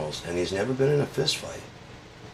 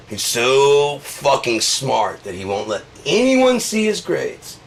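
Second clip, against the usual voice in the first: mock awe; 5 semitones higher, 5 dB louder, slower than usual. Mocking Trump's grades.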